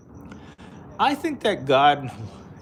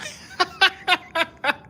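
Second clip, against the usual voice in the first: high-pitched burst of laughter; real laugh. Real, not acted. Streamer amused by peep show comment, high laughing fit. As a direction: crack up in a high giggle.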